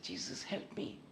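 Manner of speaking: a breathless whisper, no voice at all; whispered prayer. Retold or imitated. A defeated man whispering his plea to Jesus. Desperate prayer after outburst.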